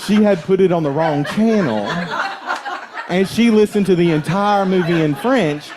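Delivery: can barely get it out for laughing. Real, not acted. Punchline told while laughing.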